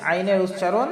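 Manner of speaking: usual speaking voice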